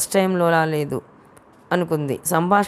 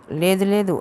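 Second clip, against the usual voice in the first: ordinary delivery, nothing marked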